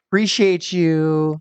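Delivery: slow, drawn-out fake thanks; sarcastic. Wrestler signing for an annoying fan, sarcastic. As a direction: stretch out an insincere thank-you. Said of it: "She was totally sarcastic".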